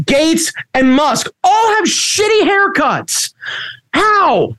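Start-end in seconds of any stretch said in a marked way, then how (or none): 1.4-4.6 high, slow disbelieving question; incredulous. Real, not acted. Ranting to his cohost about billionaires, incredulous. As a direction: stretch it out in disbelief.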